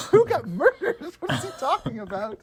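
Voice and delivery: high, incredulous question breaking into laughter; baffled reaction. Retold or imitated. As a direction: act out total bafflement, laughing. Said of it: "And I'm like".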